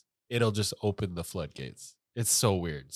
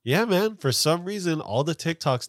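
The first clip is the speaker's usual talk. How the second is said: excited; 7 semitones higher, 4 dB louder than usual. Acted. Scripted hook delivery.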